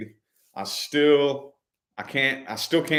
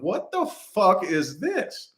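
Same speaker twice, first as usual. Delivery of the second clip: drawn-out higher confused question; slow dazed bewilderment; 8 semitones higher, much slower than usual. Retold or imitated. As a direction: stretch it out, totally lost. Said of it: "And I'm like".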